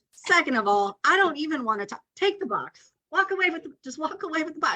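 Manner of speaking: exasperated, as if shooing someone away; exasperated brush-off. Retold or imitated. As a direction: pitch climbing in mock exasperation. Retelling her exasperated brush-off.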